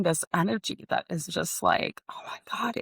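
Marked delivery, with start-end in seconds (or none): 2.1-2.8 breathless, voiceless whisper of wonder; hushed, amazed whisper; awed whisper. Retold or imitated. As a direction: whisper it like something sacred just appeared. Awed inner voice, feeling energy.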